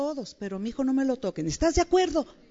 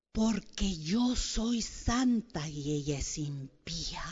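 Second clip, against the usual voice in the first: slow mock self-righteous; much slower than usual. Acting out jealous self-righteous thoughts.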